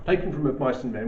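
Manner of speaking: ordinary delivery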